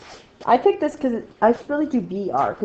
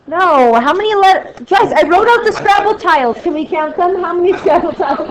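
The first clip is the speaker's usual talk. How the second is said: high, loud exasperated questions; exasperated high plea; 8 semitones higher, 7 dB louder than usual. Real, not acted. Teacher pleading with the class to count tiles, high and loud. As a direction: push the class for the answer, voice climbing.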